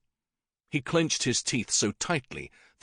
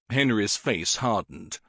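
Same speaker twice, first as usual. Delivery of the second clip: usual speaking voice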